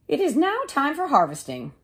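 high, bright hen voice; little red hen. Acted. The hen asking neighbours about seeds, in a high voice.

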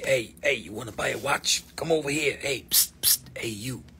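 hushed shady watch seller; sketchy street hustler with a whispered psst; trench coat voice. Pretend. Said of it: "I'm gonna give you my trench coat voice".

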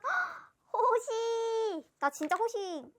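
high, loud, long-held name; drawn-out fan squeal; thrilled cry. Real, not acted. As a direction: call out the name, stretching it in delight. Pulls favourite member's card.

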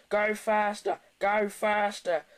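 high-pitched urging chant; mischievous trucks. Acted. Trucks egging Thomas on.

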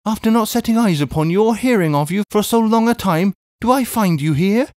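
high-voiced, overwrought Miss Pross; emotional cry. A narrator's reading. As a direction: pour it out high, as to a long-lost brother. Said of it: "cried Miss Pross".

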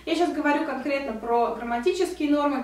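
normal talking voice

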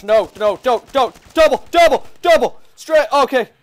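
high, loud frantic callouts; urgent yelled callouts. Real, not acted. Gamer shouts callouts mid-fight, urgent. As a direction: bark out each call in a panic. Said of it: "Sorry for yelling".

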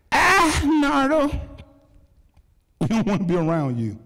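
imitating inmates pushing him away loudly; mock rejection. Inmates telling Nardo to stay away, in a loud mock cry.

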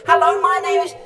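very high, loud little satellite voice; shouting back. A narrator's reading. Sinclair answering the passing object, shouted high. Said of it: "had Sinclair shouted back".

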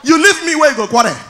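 ordinary talk, nothing marked

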